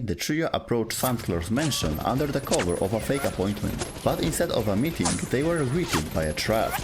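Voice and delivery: usual speaking voice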